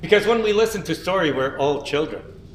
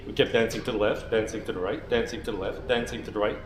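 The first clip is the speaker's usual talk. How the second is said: much lower and softer, dancing on; hushed narration; 8 semitones lower, 7 dB quieter than usual. A narrator's reading. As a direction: let it drop to a low, soft murmur. Only Amik keeps dancing.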